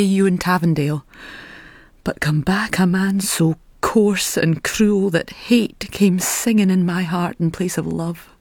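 normal talking voice

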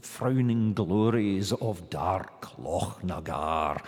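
put-on Scottish accent, "frowning glories of dark Loch na Gar" with rolled Rs and a throaty ch in "Loch"; lightly voiced Scottish reading of the line. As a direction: savour the Scottish Rs slowly, a touch of recital. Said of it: "it's a wonderfully rhotacistic music".

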